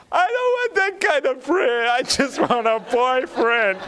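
squeaky-high, hammed-up protest; young lady's protest. Young lady rejects the offered prayer, mock high wail. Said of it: "I had a young lady in our house".

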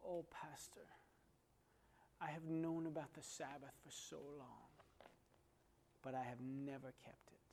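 soft, subdued mock-weepy confession; hushed, lightly sorrowful admission; retold tearful confession. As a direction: quietly imitate a woman confessing through tears, understated. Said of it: "She began to cry, and she said".

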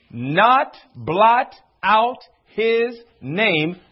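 "Not blot out his name" very slowly, every word separate; word-by-word emphasis. Real, not acted. Preacher drives the promise home to congregation, stressing every word.

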